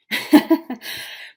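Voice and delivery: short breathy chuckle. Real, not acted. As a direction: laugh lightly to yourself. Amused at her own point.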